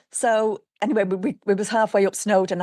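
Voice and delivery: ordinary delivery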